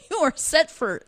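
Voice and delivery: amused, pitch riding up on a laugh. Real, not acted. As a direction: let a real chuckle lift the words. Laughing at her own remark.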